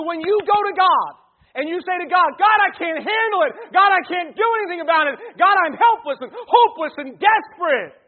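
loud, high, fervent preaching; preaching at full force. Acted. Pastor preaches to the congregation about anxiety, loud and fervent. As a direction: drive the point home to the whole church.

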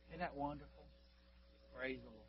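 soft, reverent wonder; hushed praise. Real, not acted. Preacher to congregation after the conversion, hushed praise. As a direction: drop almost to a breath.

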